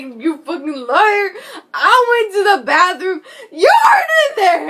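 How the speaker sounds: high, loud mock-furious mom, half laughing; angry mom. Teen imitating her mom catching her lying, loud and laughing. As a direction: shout it as the caught-out mom, cracking up.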